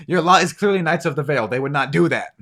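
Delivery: loud, high insistent voice; emphatic protest. Acted. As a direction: insist on it at full volume. Woman protests, restarted after fluff.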